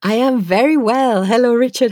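usual speaking voice

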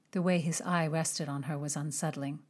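ordinary delivery

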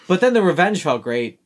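ordinary talk

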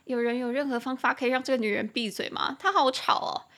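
hammed-up exasperation, joking; mock annoyed joke. Joking to friends about a chatty woman, mock annoyed. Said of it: "你就可以开玩笑说".

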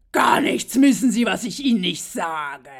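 loud, slow, bossy mock scolding; imitated angry clerk. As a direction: lay down the law, slowly and loudly. Wütender Zollbeamter, nachgespielt. (laut und langsam nachgespielte Zurechtweisung)

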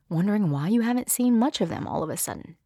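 ordinary talk, nothing marked

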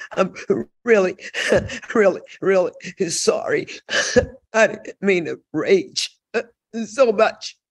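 laughing through a teary apology; sorry, half laughing half crying; tearful laughing apology. Retold or imitated. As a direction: giggle out the sorry between laughs. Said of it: "I had the tears going".

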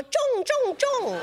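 high calling to a male dog. Retold or imitated. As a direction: call the dog in a high chant. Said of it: "they go".

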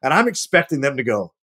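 ordinary talk

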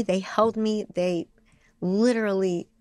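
ordinary talk

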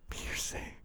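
Hearsay whispered with no voice; soft aside whisper of Hearsay. Real, not acted. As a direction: whisper hearsay under breath. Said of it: "I'm surprised you didn't throw another R".

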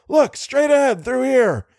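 high-pitched excited call; urgent shout. A narrator's reading. Driver tracks the fleeing monster, shouting. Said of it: "shouted Little".

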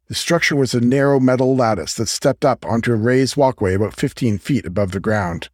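usual speaking voice

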